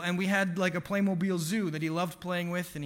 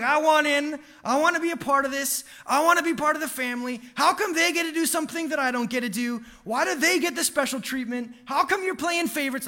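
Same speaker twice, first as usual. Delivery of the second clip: higher, hammed-up yell of a toddler left out; mock toddler yell; 6 semitones higher, faster than usual. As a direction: play the shut-out little brother yelling at the gate. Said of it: "he's screaming and yelling".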